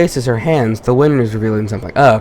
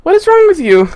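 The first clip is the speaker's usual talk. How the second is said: put-on high, fast falsetto with a British accent; British schoolgirl falsetto; faster than usual. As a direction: snap at him in a put-on British girl voice. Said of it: "I don't know why I sound like British".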